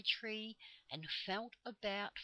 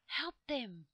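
high, forceful stage whisper of leaves; 5 semitones higher, 5 dB louder than usual. A narrator's reading. Leaves plead with listening children, in a high whispering voice. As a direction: whisper urgently as the leaves of a tree. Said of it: "the leaves whispered".

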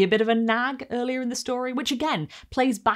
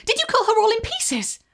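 put-on a thrilled child, much higher; breathless little boy; 6 dB louder than usual. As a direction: gasp it out like a small boy hooked on a gory tale. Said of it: "the little boy asked breathlessly".

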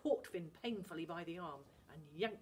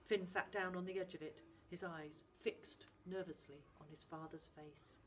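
usual speaking voice

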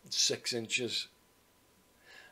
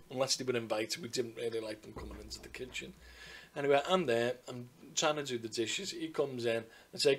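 usual speaking voice

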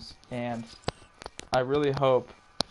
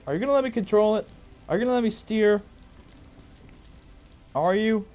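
raised, higher-pitched exasperated questioning; annoyed demand; 5 semitones higher, 4 dB louder than usual. Real, not acted. Gamer asking the game to let them steer, annoyed demand.

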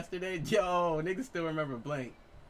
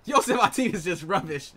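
put-on British accent; 5 semitones higher, 9 dB louder than usual. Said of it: "use that accent".

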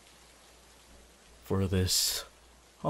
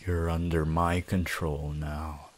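usual speaking voice

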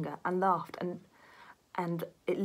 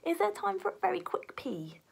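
anxious high whisper; 12 semitones higher than usual. Acted. Beef anxiously asks for toilet.

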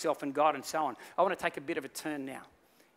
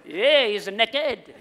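loud, drawn-out mock French accent; 6 semitones higher, 10 dB louder, much slower than usual. Little boy exposes the king, comic French accent. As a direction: stretch it out in a high French accent. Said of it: "Or a French accent".

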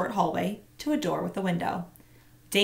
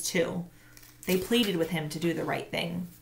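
plain reading voice

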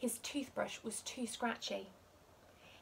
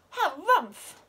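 loud, higher, huffing harumph; big grumpy harumph; 6 semitones higher, 12 dB louder than usual. A narrator's reading. Pom Pom crosser at his noisy brother, loud harumph. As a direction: blast out the grump. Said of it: "said Pom Pom".

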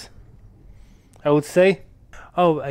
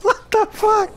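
giddy shocked outcry; high excited disbelief; slower than usual. Real, not acted. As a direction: squeal in amazement. Trap goes haywire.

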